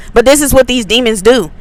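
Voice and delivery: usual speaking voice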